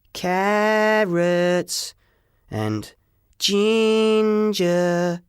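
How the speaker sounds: mock the redhead like a bully; bully taunting. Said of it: "hear him calling me".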